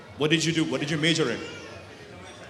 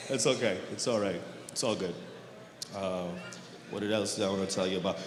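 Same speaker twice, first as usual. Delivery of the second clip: self-soothing; 12 semitones lower, 10 dB quieter than usual. Real, not acted. Calming himself after crowd silence.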